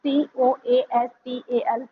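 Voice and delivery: slow letter-by-letter spelling; spelled out letter by letter. Shown as a demonstration. Teacher spelling a word slowly for students. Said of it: "coastal".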